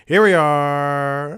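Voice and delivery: slow, loud drawn-out welcome; drawn-out greeting. Acted. Host greeting the listeners in a slow drawn-out way.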